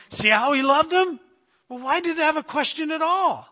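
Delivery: skeptical bystander. Retold or imitated. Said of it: "Some are going".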